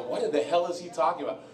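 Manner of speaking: ordinary talk, nothing marked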